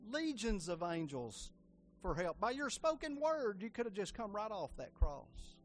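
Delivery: earnest spoken prayer to Jesus. Acted. Preacher leading the church in prayer, earnest.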